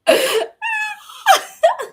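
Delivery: very high cackling laugh; shrieking laugh. Real, not acted. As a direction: burst into a squealing cackle. Laughs at her scheme.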